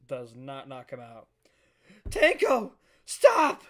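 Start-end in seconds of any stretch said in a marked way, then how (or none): 2.1-2.7 Tanko shouted high and very loud; screamed name. Acted. Friend screams Tanko, high and loud. 3.0-3.6 high, loud scream of a name; desperate shout. Acted. Friend screams at Tanko to stop, very loud.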